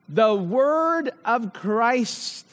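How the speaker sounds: "the word of Christ" slowly, weight on "word"; stress on word of Christ. Shown as a demonstration. Preacher driving home the phrase, slow emphasis. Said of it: "I emphasized here".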